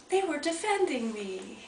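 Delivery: a quiet dawning of gratitude; touched realization. Retold or imitated. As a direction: breathe it out in wonder. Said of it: "all of a sudden I thought".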